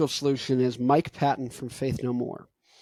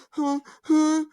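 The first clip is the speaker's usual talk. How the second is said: high, fast, anxious gasping worry; mock panic, high and breathless; anxious panting; 12 semitones higher, 4 dB louder than usual. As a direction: pant out the doubt in a high fretful voice. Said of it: "if I'm going".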